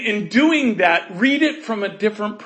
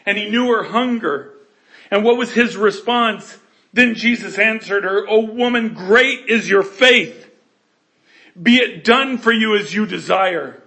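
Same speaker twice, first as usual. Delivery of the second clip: louder, rising proclamation; full-voiced sermon peak; preaching climax; 3 semitones higher, 7 dB louder than usual. Real, not acted. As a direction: proclaim it to the back row.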